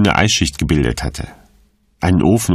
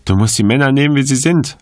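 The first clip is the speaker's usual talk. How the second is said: higher, light quoted voice; joking; 8 semitones higher, faster than usual. A narrator's reading. Woman quotes her carefree friend's advice, joking and higher. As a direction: toss it off lightly in a raised pitch. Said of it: "Sie hat gelacht und gescherzt".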